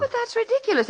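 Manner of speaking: high, scoffing disbelief; incredulous outburst. Acted. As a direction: laugh off something absurd. Wife scoffs at bell legend.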